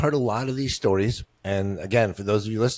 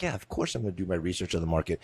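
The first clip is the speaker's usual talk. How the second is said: imitated offhand agreement, quick and lower; breezy assurance; 5 semitones lower, 7 dB quieter, faster than usual. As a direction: toss it off quietly and quickly as if it's easy. Voices a buyer finding it easy.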